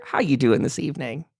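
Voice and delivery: normal talking voice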